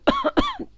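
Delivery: loud, high choking cough; coughing fit. Acted. Choking on string in a sandwich, coughing fit. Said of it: "prise d'une canne de toux".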